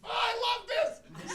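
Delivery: breaks into high laughter mid-line; cracking up at his own story; real giggling fit; laughing. Real, not acted.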